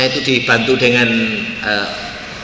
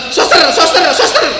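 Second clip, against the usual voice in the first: much higher, louder calls with a laugh; calling the nurse; 8 dB louder than usual. Retold or imitated. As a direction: yell for the nurse. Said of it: "ada orang teriak-teriak".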